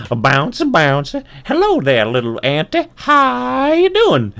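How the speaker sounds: high, loud kangaroo voice; bouncy kangaroo. Acted. Kangaroo greeting the little ant, high bouncy voice. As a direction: bound through it, stretching the last words. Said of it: "And the kangaroo says".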